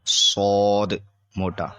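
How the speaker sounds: the heavy Arabic saad; a firm, heavy demonstration. Shown as a demonstration.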